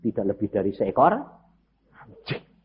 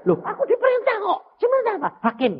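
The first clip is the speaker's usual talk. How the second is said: acted dialogue; 10 semitones higher, 4 dB louder, faster than usual. Acted dialogue soldier and judge.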